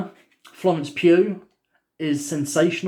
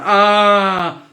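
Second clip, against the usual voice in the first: excited yelp; 3 semitones higher, 8 dB louder than usual. Retold or imitated. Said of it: "we're like".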